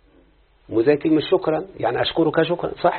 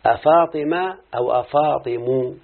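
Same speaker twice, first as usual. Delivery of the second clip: both forms drawn out slowly; slow contrast of two forms; slow demo; much slower than usual. Shown as a demonstration. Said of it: "إذا قلت أفاطمة".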